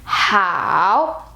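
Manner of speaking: 好 drawn out, dipping low then rising; third tone. Shown as a demonstration. Teacher shows 好 is also third tone, low dip. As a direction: sink to the bottom on 好 and climb back up. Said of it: "is also the third tone".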